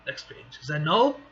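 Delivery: ordinary delivery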